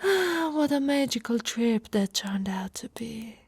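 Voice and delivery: dreamy hushed recollection; intimate whisper. Acted. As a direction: whisper the memory close to his ear. Recalling romantic hot spring trip.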